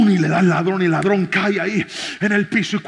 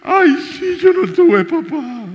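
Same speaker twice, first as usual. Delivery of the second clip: crying thief; 10 semitones higher, 9 dB louder, slower than usual. Retold or imitated. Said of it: "y empieza a llorar".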